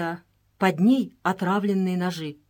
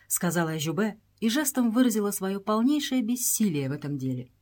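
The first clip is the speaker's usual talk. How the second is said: plain reading voice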